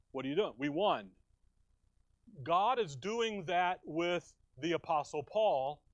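normal talking voice

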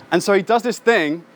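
ordinary talk

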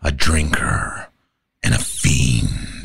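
a low Joker, menace held back. Shown as a demonstration. Joker telling the scars story, low menace. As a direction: let the words drop dark and low.

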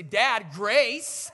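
put-on loud, insistent kid calling on dad; son's loud demand. Son calls on his dad for grace, loud demand. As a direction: call it out like a demanding child.

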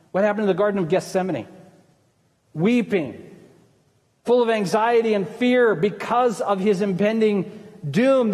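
ordinary talk

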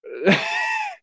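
high howl at the moon; wolf howl. Pretend. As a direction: throw your head back and howl. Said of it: "make a little howl at the moon".

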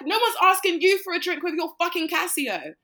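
angry rant at a man in the club; retold angry rant. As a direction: put him in his place. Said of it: "I was like".

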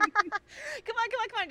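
high laughing come on; egging her on through laughter; laughing urging. Real, not acted.

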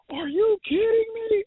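high, sharp mock yell of the coach; imitating coach's outburst. Coach catching players laughing late, mock outraged cry. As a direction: do the coach's trademark disbelief. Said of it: "Oh, that was his favorite".

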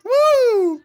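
very high, drawn-out mock whoop; high cartoon whoop. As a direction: whoop like an overexcited cartoon character. Joining the cartoon duck impression.

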